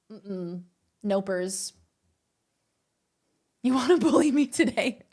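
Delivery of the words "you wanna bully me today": laughing. Real, not acted. Teasing a chatter.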